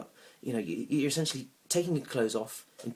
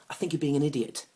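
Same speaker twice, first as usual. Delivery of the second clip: loud, higher-pitched retort; annoyed outburst; 5 semitones higher, 7 dB louder than usual. Acted. Man after counting to ten, annoyed. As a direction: burst out, patience gone. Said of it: "I was getting so annoyed".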